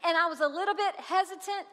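ordinary delivery

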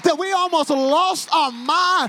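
loud, high, sing-song preaching; whooping preacher at full volume; full-force preaching. Acted. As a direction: drive the crowd to praise.

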